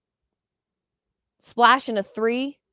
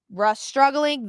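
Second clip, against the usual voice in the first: usual speaking voice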